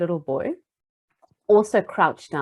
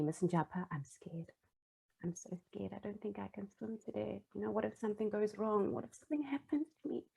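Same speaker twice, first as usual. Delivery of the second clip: put-on soft, hushed child's voice, full of fear; scared little boy; 17 dB quieter than usual. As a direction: whisper it like a frightened kid at the pool.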